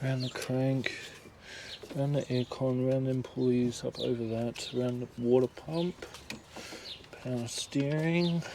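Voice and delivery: quiet, half-voiced routing to himself; muttering to himself. Real, not acted. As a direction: mumble it under your breath. Talking himself through the belt routing.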